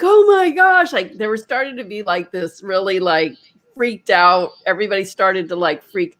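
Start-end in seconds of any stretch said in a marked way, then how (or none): 0.0-0.9 high, loud startled gasp; gasped alarm. Retold or imitated. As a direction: react like the whole cabin just panicked. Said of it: "everybody's like".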